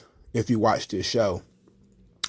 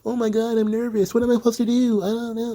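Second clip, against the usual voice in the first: much higher, whiny panicked voice; mock a jittery young wrestler; mock nervous whine; 11 semitones higher than usual. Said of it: "these new generation of wrestlers where they're kinda".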